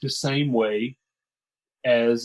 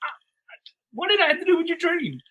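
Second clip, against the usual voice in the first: mock disbelief. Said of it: "I'm like".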